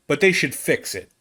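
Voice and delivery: usual speaking voice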